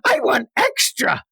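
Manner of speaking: put-on very high childish demand; begging little kid. Child asking Mommy for seconds, squeaky mock begging. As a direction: stutter it out like an eager kid.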